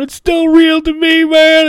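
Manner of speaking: high, wailing, tearful voice; mock crying. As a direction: imitate a grown man sobbing his line. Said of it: "he's crying".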